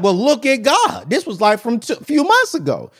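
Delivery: high-pitched, acted happy surprise; delighted discovery. Finding a five dollar bill in the pockets, delighted discovery. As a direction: exclaim it high, like stumbling on forgotten cash.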